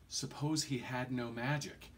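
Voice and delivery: usual speaking voice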